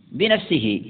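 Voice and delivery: ordinary delivery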